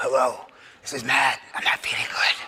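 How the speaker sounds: weak, hushed, put-on sick voice; practiced sick voice. Young employee rehearsing a sick call, feeble put-on voice. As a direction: fake being ill in a soft, low near-whisper. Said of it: "I was practicing my sick voice".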